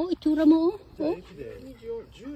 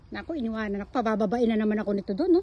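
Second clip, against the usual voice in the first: ordinary delivery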